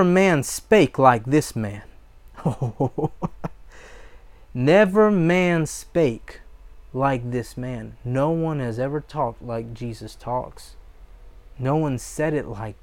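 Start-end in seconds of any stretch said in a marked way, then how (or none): 4.5-8.0 slow right down, leaning on "Never", then drop away; slow, weighty repeat. Acted. Repeats the answer slowly to savor.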